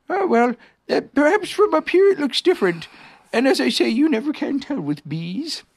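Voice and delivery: high-pitched, musing bear; Pooh bear, philosophical. Acted. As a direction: reason it out as a high-voiced bear.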